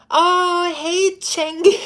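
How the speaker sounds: high, loud happy shout-out; excited greeting. Real, not acted. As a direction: greet a viewer with delight. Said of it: "Chengi was just doing a live".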